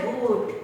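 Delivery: GOOD with the vowel drawn out slowly; prolonged O. Shown as a demonstration. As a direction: stretch GOOD into a long "oo". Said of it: "you are prolonging it".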